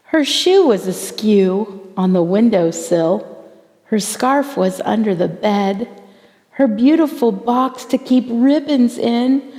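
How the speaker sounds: ordinary delivery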